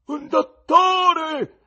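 very slow, loud, high wail; terrible scream. A narrator's reading. Severed head screaming to the theatre, loud wail. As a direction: scream for help in terror. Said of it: "gridò tremendamente".

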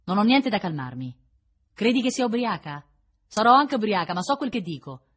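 loud, heated retort; agitated defiance. A narrator's reading. Drunk prisoner told to calm down, loudly defiant. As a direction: fire back, refusing to be calmed.

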